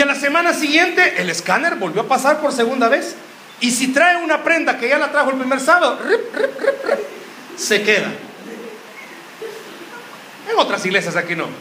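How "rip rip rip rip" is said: high squeaky mock beeps, laughing through them; laughing scanner beep. Preacher mocking church girls, high laughing scanner beeps. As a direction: chirp it like a scanner while giggling.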